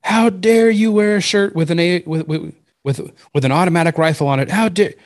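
high, outraged stranger's scolding; imitated yelling. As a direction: mimic a lady yelling at you. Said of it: "this lady starts yelling at me".

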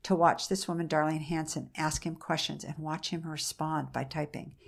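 usual speaking voice

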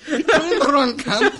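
high, laughing mock Scottish accent; put-on Scottish accent. Player ribbing the host, laughing Scottish accent. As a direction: tease in a rough Scottish accent. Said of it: "I said I'm Scottish".

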